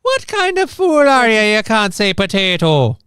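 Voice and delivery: high, loud stage-Irish lilt; mock outrage in a broad Irish accent. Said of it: "in an Irish accent".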